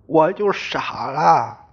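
ordinary delivery, nothing marked

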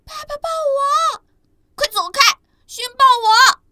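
tiny dinosaurs pushing to be hugged first; squabbling baby dinosaurs. Acted. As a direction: babies whining for dad's hug. Babies squabbling to be held.

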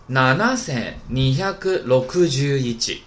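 七千二百六十一 in Japanese, "nanasen nihyaku rokujū ichi", very drawn out; linked up slowly. Shown as a demonstration. Teacher models a long number for students, 七千二百六十一 very slowly in Japanese. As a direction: stretch each part of 七千二百六十一 slowly in Japanese. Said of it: "连上".

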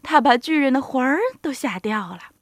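ordinary delivery

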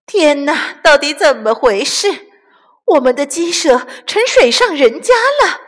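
high loud amazed cry; shouting. Acted. Camela shouting to the others about the floating henhouse. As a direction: call out in astonishment. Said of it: "卡梅拉大喊道".

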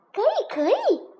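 high, loud, eager double yes; hasty agreement. A narrator's reading. Princess agrees without thinking, hasty and eager. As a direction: agree without a moment's thought. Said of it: "小公主想都没想就说道".

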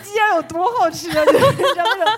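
high, loud, slow words broken by real laughter; laughing. Real, not acted. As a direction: laugh through the words, almost in tears. Laughing while recalling the passenger.

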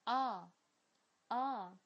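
R，R。 as a long open back "ah" without curling the tongue; British ah vowel. Shown as a demonstration. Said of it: "因为它是一个后元音".

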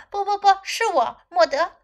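high, lifted protest; excited storybook dialogue; insistent correction. A narrator's reading. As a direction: insist on who you are. Character insists on who she is.

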